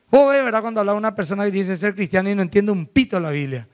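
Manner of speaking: ordinary delivery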